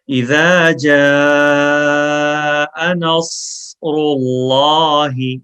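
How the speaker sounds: slow, melodic tajweed chant; Quran recitation. Shown as a demonstration. Teacher reciting for students to repeat after, slow melodic chant. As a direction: recite it slowly for students to echo.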